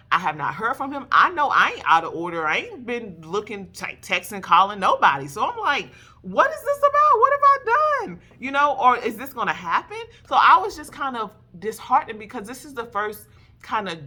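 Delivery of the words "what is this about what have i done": sudden high, louder cry of dismay; distressed outcry. Retold or imitated. Woman crying out to God over a confusing dream, high and dismayed. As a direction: throw it up to heaven in disbelief.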